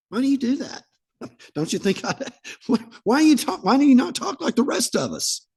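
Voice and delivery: high, amazed, half-laughing why; playfully baffled, high-pitched asking; incredulous question. Retold or imitated. Comedian quoting puzzled questioners.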